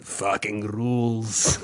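low, very slow Russian approval; deep Russian accent, long rolled-out words. Retold or imitated. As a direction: savour it as the Russian guy. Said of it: "in his Russian accent".

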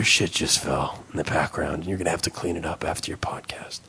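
low raspy whispered doctor; a grim doctor, whispered fast; mock doctor whisper.

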